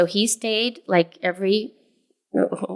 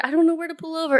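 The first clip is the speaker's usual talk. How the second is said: flustered panic; 10 semitones higher, much faster than usual. Retold or imitated. Panicked driver quoted.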